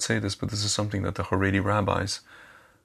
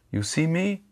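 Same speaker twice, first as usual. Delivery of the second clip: put-on kid's Haredi accent, pitched much higher; imitating Haredi accent; 8 semitones higher, slower than usual. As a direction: mimic the sweet Haredi kid's accent, voice up high. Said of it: "He had a Hax accent".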